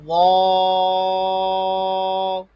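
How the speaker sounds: وا as a thick, rounded ẓwa held very long; heavy zwa. Shown as a demonstration. Teacher models the fifth heavy letter, long thick وا. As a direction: sustain وا loud and full-mouthed. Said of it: "اس کو بھی موٹا پڑھیں گے".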